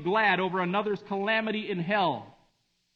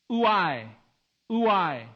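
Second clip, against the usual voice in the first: "Ouai" detto oo-AI, con dolore, cadente; sorrowful ouai; 4 dB louder than usual. Shown as a demonstration. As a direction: lascia cadere "ouai" come un lamento. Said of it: "Even its sound conveys sadness".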